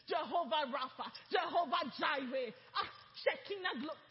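loud, rising praise shouted to God; full-force preaching. Acted. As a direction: build up and call out every name.

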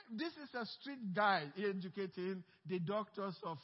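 ordinary delivery

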